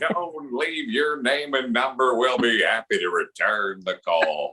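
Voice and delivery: slow broadcaster sign-off, sinking low; Harry Kalas. Acted. Announcer finishing a fan's voicemail greeting, slow and low. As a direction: wind down in a deep announcer drawl. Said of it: "the outgoing message on your cell phone".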